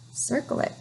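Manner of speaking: soft unvoiced whisper; hushed teacher aside. Real, not acted.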